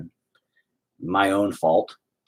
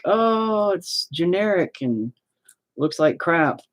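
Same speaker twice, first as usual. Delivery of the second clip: lightly voiced sneering critic, pitched higher; a hint of a snooty buyer, higher; mocking critic; 11 semitones higher, much slower than usual. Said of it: "people are like".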